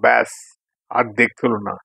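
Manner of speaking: ordinary delivery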